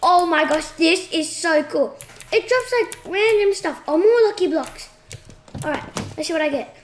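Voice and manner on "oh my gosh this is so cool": usual speaking voice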